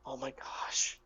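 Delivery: hushed, worried whisper; concerned. Acted. Gnome realising the danger, worried whisper. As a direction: breathe it out under your breath in dread. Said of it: "really concerned look over their face".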